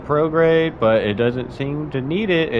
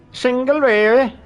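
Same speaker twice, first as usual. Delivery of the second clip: "Single Bailey" even higher and louder in mock German accent; second German accent try; 13 semitones higher, 6 dB louder than usual. Streamer retries German accent for chat, louder mock German. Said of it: "Pronounce it with a thick German accent".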